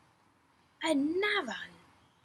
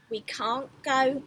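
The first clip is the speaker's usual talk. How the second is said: usual speaking voice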